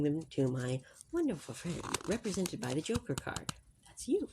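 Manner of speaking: hushed library voice, barely above a breath; library whisper. Real, not acted. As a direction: keep it soft so nobody in the library is disturbed. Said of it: "we always use our library voices in the library".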